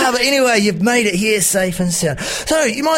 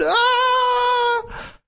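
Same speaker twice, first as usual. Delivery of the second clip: pirate scream. Acted. Pirate fears the custard.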